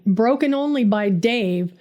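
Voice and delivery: ordinary delivery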